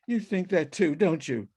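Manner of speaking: usual speaking voice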